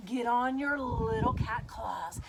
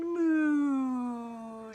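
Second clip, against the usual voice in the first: loud, long playful moo; cow moo; 7 dB louder than usual. Pretend. Acting a jungle cow.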